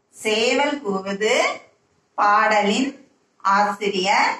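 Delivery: slow, phrase by phrase with long gaps. Shown as a demonstration. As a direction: lead the class slowly, rising at breaks. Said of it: "ஒரு வாட்டி என் கூட சொல்லுங்க". (சேர்ந்து சொல்ல மெதுவாக, பிரித்து)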